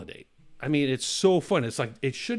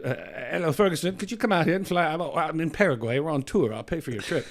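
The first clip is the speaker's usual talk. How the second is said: higher-pitched British rock star voice; 4 semitones higher, 3 dB louder than usual. Retold or imitated. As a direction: imitate a comedian's impression of an English rocker on the phone. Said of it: "he does his voice, he does his impression".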